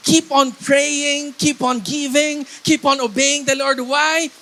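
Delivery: loud, emphatic exhortation in driving phrases; rally the congregation, stretching each command; full-force preaching. Real, not acted. Preacher urges church to keep worshiping, full-force preaching.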